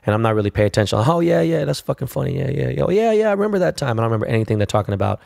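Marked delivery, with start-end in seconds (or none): 1.0-4.0 high, absent-minded yeah yeahs; distracted fake agreement. Half-listening at dinner, pretending to follow the story, mock-distracted agreeing. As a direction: nod along without listening.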